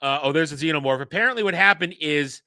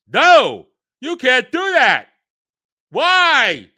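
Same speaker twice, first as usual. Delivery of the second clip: higher, louder whiny mock of a bad line reading; mocking the actress; 7 semitones higher, 8 dB louder, slower than usual. Reviewer parodying Wendy's overacted protest, higher and louder. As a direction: ham up a melodramatic protest. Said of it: "she is a horrible actress who says the dumbest things".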